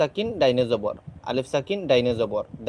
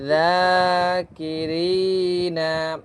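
each word drawn out slowly with long madd; elongated vowels; 3 semitones higher, much slower than usual. Shown as a demonstration. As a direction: read each word stretching the vowel one alif. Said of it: "এক আলিফ লম্বা করে পড়তেছি". (মদ টেনে ধীরে পড়া)

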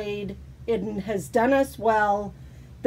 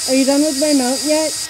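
impatient nagging; 2 semitones higher, 5 dB louder than usual. Acted. Repeated nagging, louder.

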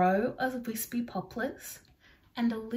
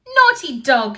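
loud scolding shout at the dog; girl shouting at her naughty dog; 5 semitones higher, 14 dB louder than usual. Acted. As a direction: tell off the muddy dog. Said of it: "shouted Julie".

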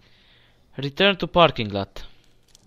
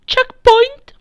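excited shout; 15 dB louder than usual. Real, not acted. Reaching a game checkpoint.

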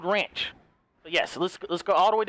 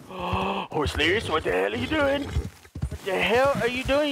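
exasperated scolding; slower than usual. Real, not acted. Scolding his horse, drawn out.

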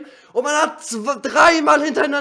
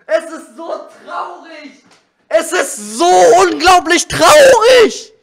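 berserk rage; 3 semitones higher, 10 dB louder, slower than usual. Real, not acted. Gamer raging at broken controller.

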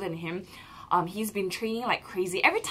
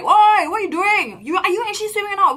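loud, higher mock coach yelling; imitating scolding coach; 11 semitones higher, 10 dB louder than usual. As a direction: bark at lazy swimmers like a coach. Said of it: "the coach scolded us".

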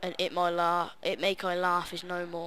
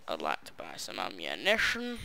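deep posh voice; 12 semitones lower, 4 dB quieter than usual. Pretend. Shopkeeper-style request in game.

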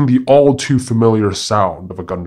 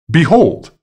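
proud cry, higher and louder; 7 semitones higher, 5 dB louder than usual. A narrator's reading. Monkey addressing all the gathered woodland animals, crying out. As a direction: call it out high and loud, like a proclamation. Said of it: "cried out the monkey".

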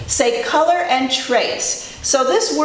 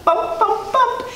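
high-pitched truck bumps; high bouncy bumping; 14 semitones higher, slower than usual. A narrator's reading. Little Blue coming into the mud, high bumps. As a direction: bounce each bump up high.

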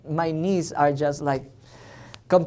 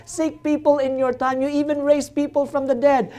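pleading prayer; 6 semitones higher than usual. Retold or imitated. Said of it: "I came to the Lord and I said".

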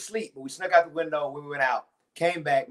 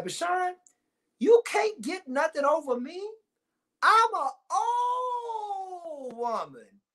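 upset grandmother; 13 semitones higher, much slower than usual. Retold or imitated. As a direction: scold like an upset grandma holding words. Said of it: "this is my grandma when she's upset".

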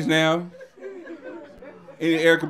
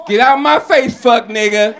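mock angry young woman; 4 semitones higher, 6 dB louder than usual.

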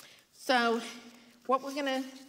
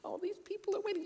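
fretful thought; 12 semitones higher, 5 dB quieter, faster than usual. Retold or imitated. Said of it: "and I was thinking".

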